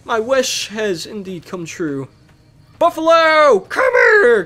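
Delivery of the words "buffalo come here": high loud call; summoning shout. Real, not acted. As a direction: shout across the plain to the herd. Calling buffalo in game.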